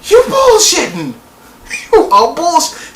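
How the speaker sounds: high, slow disbelieving cry; can't believe what he's watching; disbelief. Retold or imitated. Him reacting to the show in high, slow disbelief. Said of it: "I've been watching this thing like".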